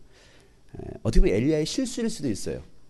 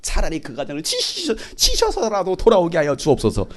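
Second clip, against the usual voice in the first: harsh mock prayer; 4 semitones higher than usual. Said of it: "험악한 기도를 해요".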